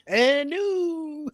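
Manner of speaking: drawn-out, high announcer call; loud, high title-change proclamation; ring announcer. Retold or imitated. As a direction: announce a new champion, stretching the last word.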